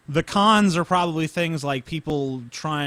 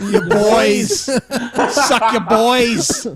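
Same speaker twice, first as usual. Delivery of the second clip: laughing; 8 semitones higher, 9 dB louder, much slower than usual. Real, not acted. Mocking the impression, laughing.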